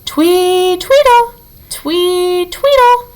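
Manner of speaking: high chirping birdsong imitation; chickadee song. A narrator's reading. Narrator imitating chickadees heard by the robot, high chirps. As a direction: chirp it out like a little bird, way up high. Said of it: "their song had sounded like".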